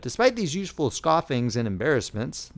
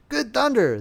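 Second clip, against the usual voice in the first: high startled outburst; exclaimed; 10 semitones higher, 4 dB louder than usual. A narrator's reading. Bewildered soldiers staring after the general, exclaiming. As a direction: blurt it out in disbelief. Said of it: "they ejaculated".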